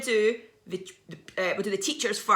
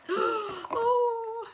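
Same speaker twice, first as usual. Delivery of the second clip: high gasping oh of delight; surprised gasp; 13 semitones higher than usual. Retold or imitated. Gasping at the hidden mouse.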